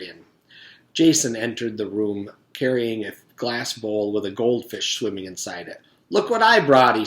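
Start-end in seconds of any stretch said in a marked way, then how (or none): 6.1-7.0 higher, louder show-off boast; proud kid bursting to show; excited boast. Acted. Jason showing the class his goldfish, excited.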